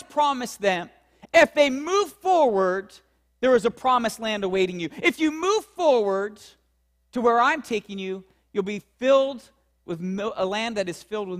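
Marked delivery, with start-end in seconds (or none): none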